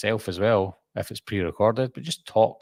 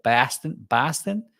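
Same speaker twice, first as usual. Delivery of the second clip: "Boston" with an open "ah", rising; higher Boston-accented "Bahston"; 4 semitones higher, slower than usual. Shown as a demonstration. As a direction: demonstrate the Boston vowel in "Boston". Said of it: "someone from Boston would say".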